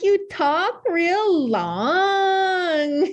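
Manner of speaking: hugely drawn-out, high mock northern accent; sing-song exaggerated northern accent, very slow. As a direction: stretch every vowel to tease the northern accent. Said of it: "making fun of my northern accent".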